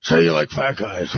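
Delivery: low, coaching schemer's voice; Levy. Acted. Sleazy man coaching the girl what to say, in a low voice. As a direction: drop the pitch and feed her the line. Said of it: "And Levy goes".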